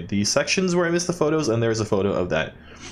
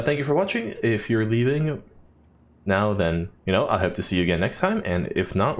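ordinary talk, nothing marked

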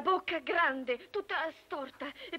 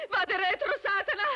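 raised, agitated cry; 4 semitones higher, 5 dB louder than usual. Acted. Woman confessing her ordeal to His Eminence, crying out. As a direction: relive the scream as you tell it. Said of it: "Io gridai".

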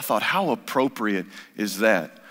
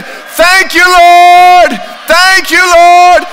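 much higher, much louder cry of thanks; 16 dB louder, much slower than usual. Acted. The healed leper returning to Jesus, a loud cry of thanks. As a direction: shout the thanks up to heaven. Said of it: "with a loud voice".

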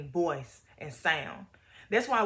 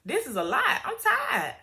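honest complaint to God; 13 semitones higher than usual. Retold or imitated. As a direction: let it all out like someone at the end of their rope. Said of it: "we're being real honest by saying".

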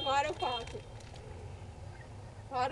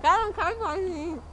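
cheeks stuffed full; 3 dB louder than usual. Real, not acted. Mouth too full.

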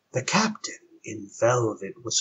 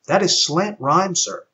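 android voice; 7 semitones higher, 4 dB louder than usual. Acted. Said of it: "the android replied".

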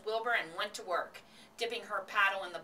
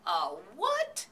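loud, startled query with a big upward swoop; baffled, rising outburst; incredulous question; 2 semitones higher, 5 dB louder than usual. A narrator's reading. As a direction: swing the pitch right up in disbelief. Husband startled by the bath announcement.

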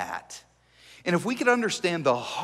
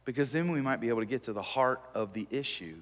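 normal talking voice

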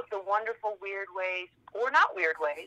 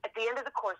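ordinary talk

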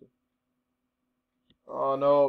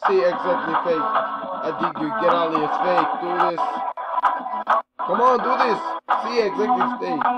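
loud, high-pitched jeering at strangers; loud taunting; 4 semitones higher, 7 dB louder than usual. Real, not acted. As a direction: yell it's fake at them, mocking. Taunting strangers on video chat.

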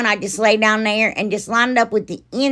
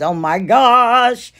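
slow, drawn-out delighted gush; 4 semitones higher, 5 dB louder, much slower than usual. Real, not acted. Thrilled about the new box from Rose, slow and gushing. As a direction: swoon over the gift box.

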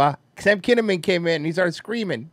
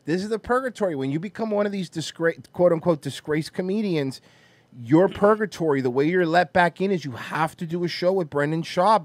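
normal talking voice

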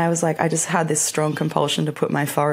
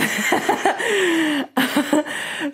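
high laughing burst; bright high laughter; 13 semitones higher than usual. Real, not acted. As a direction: giggle up high at herself. Laughing at own story.

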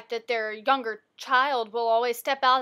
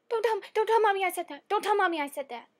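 put-on high, pleading little-kid panic; panicked child; 8 semitones higher, 3 dB louder, faster than usual. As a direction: beg in alarm after a gasp. Child panics at her slip.

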